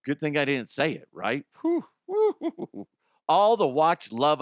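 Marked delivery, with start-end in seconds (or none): 1.6-2.9 high-pitched, airy "whew, whew"; high whooping whew. Pretend. Host dodging a word he nearly said, high airy whew.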